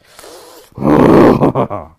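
very loud burst breaking into laughter; loud venting outburst. Acted. Pastor showing what is under the surface, loud venting outburst. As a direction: let it blow, then laugh.

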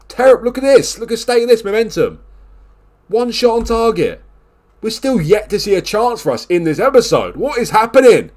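high, loud exasperated outburst at the team; high-pitched frustrated tirade; exasperated rant. Real, not acted. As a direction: rant at them, fed up and disbelieving. Furious at his team's form.

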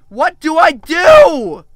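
slow, drawn-out loud wail; exasperated cry. Real, not acted. Player stuck in the game, exasperated cry. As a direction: wail the question in desperation.

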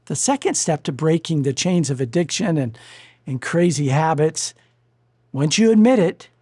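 usual speaking voice

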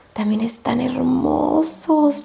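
slow whisper, stretched out in admiration; awed whisper. Real, not acted. Vlogger admiring the second highlighter duo, awed whisper. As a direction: whisper as if overcome by how lovely it is.

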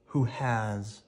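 ordinary delivery

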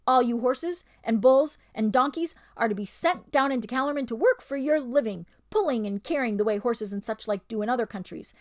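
loud, fast, domineering ape; ape's bullying voice. Acted. Ape tells the animals they'll be sent to work, loud and domineering. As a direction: rattle off orders like a tyrant.

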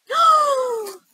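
sharp delighted high gasp; excited gasp. Acted. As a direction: gasp in sudden glee. Kai gets excited about idea.

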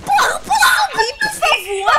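shout. Real, not acted. Frustrated outburst in game.

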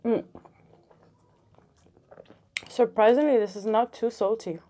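talking with mouth partly full; muffled words between chews; chewing bread. Real, not acted. Seen 2: Face clear; cheeks puffed, jaw working after bite at -12.

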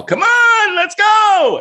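high-pitched mock holler; imitated friends' yell. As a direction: call out like friends hurrying someone from a car. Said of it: "and they yell".

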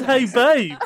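quoting himself high through laughter; laughing repeat. Retold or imitated. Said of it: "I just said".

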